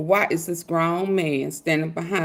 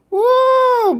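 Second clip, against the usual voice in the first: whooping laugh; 5 dB louder than usual. Real, not acted. Howling laugh at clip.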